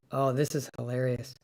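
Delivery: normal talking voice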